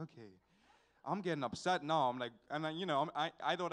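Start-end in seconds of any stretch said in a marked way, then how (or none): 0.0-0.3 very low and soft; retold grudging little acknowledgement; deflated reply. Nephew answering his bragging uncle, deflated reply.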